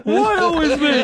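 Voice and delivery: high-pitched hammed-up wail; mock tearful whine. Host mimics tearful colleague feeling picked on, high mock crying. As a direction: imitate a grown man crying poor me. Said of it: "the fucking tears started falling".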